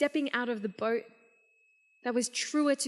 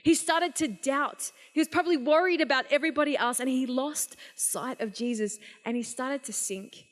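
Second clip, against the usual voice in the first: normal talking voice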